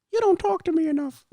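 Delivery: put-on wifely complaint; mock complaining woman. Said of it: "let's think of some excuses for the women".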